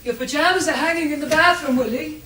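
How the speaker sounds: high, loud call to another room. Acted. Wife calls to her husband about his pajamas, calling out. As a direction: project it across the house.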